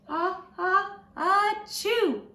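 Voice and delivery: high, loud drawn-out sneeze; big sneeze. A narrator's reading. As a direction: build up and let the sneeze burst. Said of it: "Mom nodded glumly and sneezed".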